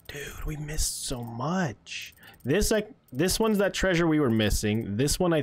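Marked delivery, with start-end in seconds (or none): 0.1-2.2 slow, soft whisper of regret; hushed groan of a missed chance; whispered dismay. Real, not acted. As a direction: breathe it out, gutted. Dismayed realization of missed loot.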